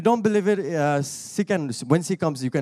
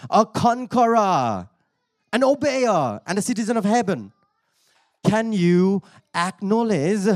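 preaching; 6 semitones higher, 4 dB louder than usual. Real, not acted. Preacher telling listeners they are healers and conquerors, rousing preaching. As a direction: build a ringing list, then challenge them.

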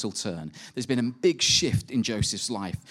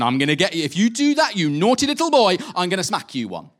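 higher, louder hammed-up scolding threat; mock angry God; 6 semitones higher, 6 dB louder than usual. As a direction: play a cartoon punishing God wagging a finger. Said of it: "God is up there going".

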